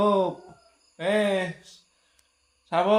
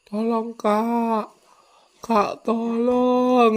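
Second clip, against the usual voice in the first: slow model request; 4 semitones higher, much slower than usual. Shown as a demonstration. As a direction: stretch out a slow, gentle tolong, Kak. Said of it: "Minta tolong kakaknya".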